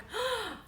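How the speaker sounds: high, quick acted gasp; shocked gasp. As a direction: gasp sharply in alarm, re-enacting. Acting out her shocked reaction.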